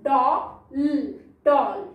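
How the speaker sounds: "डॉ", pause, "ल", then a whole "डॉल"; sounded-out, open-vowelled "डॉल"; short O. Shown as a demonstration. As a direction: blend "डॉल" with the open O of orange. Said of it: "ऑरेंज वाला".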